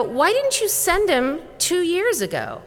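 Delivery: high-pitched retold indignation; indignant challenge. Educator confronting the patient's doctors, indignant. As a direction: demand an explanation, pitched well up.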